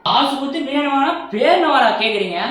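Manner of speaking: loud, high furious rant down the phone; angry complaint. Acted. Customer yelling at the biryani shop, angry shout.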